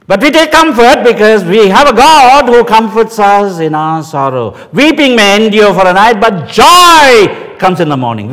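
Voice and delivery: loud, high, ringing pulpit cry; full-force preaching. Acted. Preacher proclaiming God's comfort to grieving listeners, loud and soaring. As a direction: lift the congregation with a soaring proclamation.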